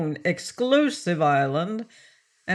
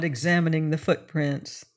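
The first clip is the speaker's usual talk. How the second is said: plain reading voice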